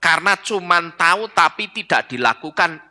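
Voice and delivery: usual speaking voice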